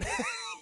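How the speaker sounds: sharp high laugh; real laughter. Real, not acted. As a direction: burst out laughing.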